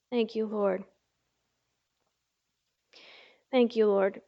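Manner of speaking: a soft, hushed thank you under the breath; murmured prayer. Real, not acted. Pastor thanking God quietly between readings, hushed. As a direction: drop to a private whisper-like prayer aside.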